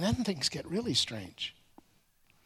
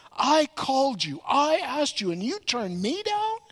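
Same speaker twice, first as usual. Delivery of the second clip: higher, affronted "you turned me down?"; indignant outburst; 7 semitones higher than usual. Retold or imitated. Gideon indignant at the village refusing him, higher, affronted. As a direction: play Gideon wounded in pride, pitched up.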